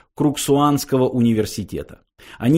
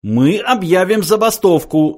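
high, loud outcry; yell; 8 semitones higher, 5 dB louder than usual. A narrator's reading. Philosopher threatening a strike, yelling. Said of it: "завопил Врумфондель".

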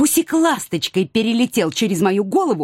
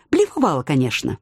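ordinary delivery, nothing marked

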